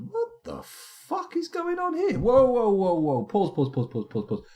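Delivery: high outburst falling into rapid repeats; real startled alarm. Real, not acted. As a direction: break off in loud disbelief, then urgently call a halt. Sudden alarm at game event.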